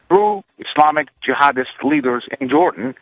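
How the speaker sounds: ordinary talk, nothing marked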